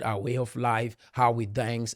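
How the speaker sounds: normal talking voice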